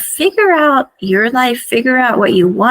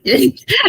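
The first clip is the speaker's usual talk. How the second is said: short real giggle; amused laugh; 4 semitones higher than usual. Real, not acted. Storyteller amused by her own retelling, short laugh. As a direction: break into a brief laugh.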